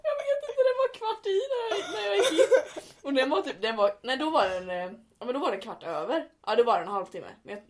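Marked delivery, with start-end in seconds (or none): 0.1-2.8 high, laughing imitation of a stranded brother; high mock-miserable answer through laughter; whiny brother. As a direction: play him pitiful and high while cracking up.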